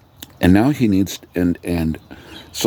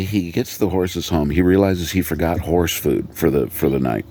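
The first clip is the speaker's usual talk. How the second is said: usual speaking voice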